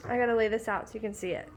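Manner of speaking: normal talking voice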